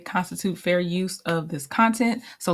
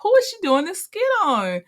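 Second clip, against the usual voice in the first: puzzled; 11 semitones higher than usual. Retold or imitated. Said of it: "I'm like".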